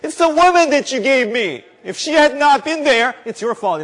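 high, loud whining blame; mocking Adam. Preacher voicing Adam blaming Eve and God, high and loud. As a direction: play Adam passing the buck, whiny. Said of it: "What did Adam say".

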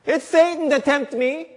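high, whiny blame on Satan; mocking Eve. Preacher voicing Eve blaming Satan, high whine. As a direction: squeak out Eve's excuse. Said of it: "what does Eve say".

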